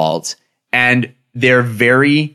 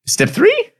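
high questioning. Real, not acted.

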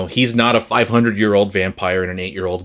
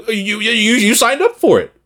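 exasperated scolding; 10 semitones higher than usual. Retold or imitated. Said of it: "And it's like".

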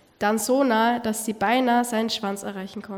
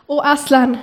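laut und freudig gerufen; freudiger Ausruf; 4 semitones higher, 7 dB louder than usual. A narrator's reading. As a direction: ruf ihn glücklich beim Namen. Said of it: "riefen die beiden Kinder". (freudiger Ruf)

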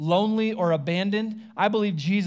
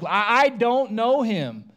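slow, loud, stammered denial; emphatic denial; 5 semitones higher, 5 dB louder, slower than usual. Retold or imitated. Peter insisting he does not know Jesus, slow and loud. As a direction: lean hard into each word. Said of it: "this is strong, like, emphatic".

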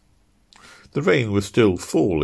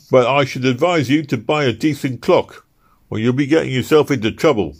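loud, high gruff constable voice; a stern London bobby challenging him; london policeman; 6 semitones higher, 5 dB louder than usual. Acted. Policeman voice in story.

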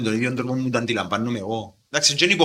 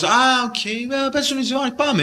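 put-on teen fan voice; 9 semitones higher than usual. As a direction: play a young fan eager to go in. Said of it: "Μπορεί να είσαι κάπως".

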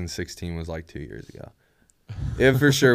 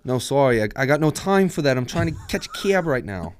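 put-on New York accent; 6 semitones higher than usual. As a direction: brush him off like a busy local. Continuing accent bit.

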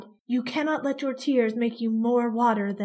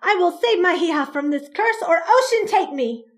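fierce oath; 6 semitones higher, 7 dB louder than usual. Acted. Mother swearing an oath.

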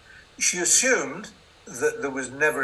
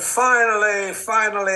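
triumphant announcement; 7 semitones higher, 8 dB louder than usual. Retold or imitated. Announcing his novel at last.

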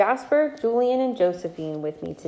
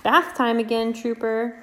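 gentle singsong read-aloud; reading to a toddler; 3 semitones higher than usual. Acted. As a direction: read it sweetly to little kids. Reads picture book to toddlers.

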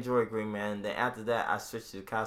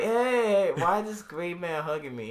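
high, loud bewildered voice; mock the puzzled stranger being hugged; imitating a baffled woman; confused; 6 semitones higher, 4 dB louder than usual.

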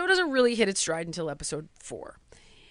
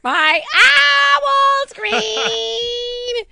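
put-on high, very slow, loud kid's shout; child yelling; 12 semitones higher, 7 dB louder, much slower than usual. Little son shouting to his parents at dawn, drawn-out yell. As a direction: stretch it out like a toddler yelling at dawn. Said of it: "imitating the son".

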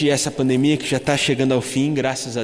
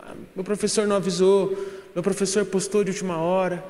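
lightly imitated higher-pitched excuse; blaming the teachers; 5 semitones higher than usual. Brother blaming his teachers for late homework, mock excuse-making. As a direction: mimic a brother shifting blame. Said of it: "ele começou a culpar os professores".

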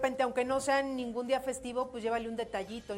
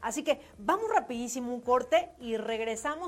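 upbeat louder host read; radio announcer; 5 dB louder than usual. Acted. Radio host announcing the break and return, upbeat. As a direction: sell the break and the comeback.

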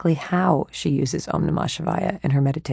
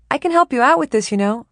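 higher, brighter, over-helpful inner voice; eager chatty mind; 8 semitones higher, 5 dB louder than usual. Acted. Her restless mind interrupting the meditation, eager and chatty. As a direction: butt in like a pushy helper.

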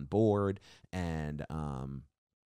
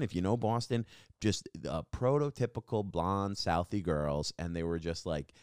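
ordinary delivery

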